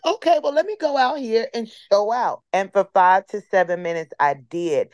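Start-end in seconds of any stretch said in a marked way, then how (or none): 0.1-2.4 pumped up. Retold or imitated. Psyching herself up to perform.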